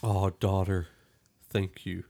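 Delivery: ordinary talk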